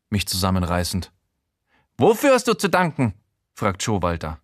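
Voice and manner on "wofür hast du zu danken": high, louder odd man's voice; a much higher, prying character voice; Showalter's voice. Acted. Showalter bursting in, high louder voice.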